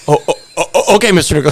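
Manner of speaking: high, loud stammering imitation; flustered delivery guy. Delivery guy told to turn the water off, flustered. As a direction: stumble over the word, hurrying to obey. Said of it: "He goes".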